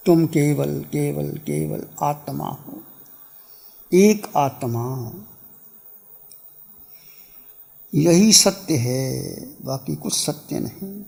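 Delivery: low meditation voice, phrases fading into silence; guided meditation. Real, not acted. Teacher guiding meditators to be still, guided meditation.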